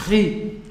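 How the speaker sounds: ri scraped in the throat; guttural ri. Shown as a demonstration. Teacher demonstrating: rasping guttural ri. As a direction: rasp the ri as if clearing a sore throat. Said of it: "você fosse falar mesmo assim".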